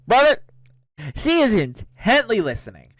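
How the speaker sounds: incredulous outburst. Real, not acted. Streamer reacting to the eavesdropping roommate, loud incredulous outburst. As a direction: burst out with it in disbelief.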